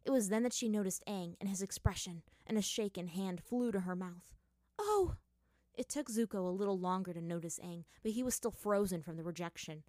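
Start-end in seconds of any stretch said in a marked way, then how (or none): 4.8-5.2 shocked gasp. Acted. Katara sees Aang, shocked.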